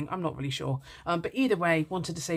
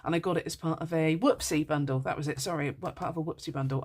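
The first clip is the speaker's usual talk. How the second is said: normal talking voice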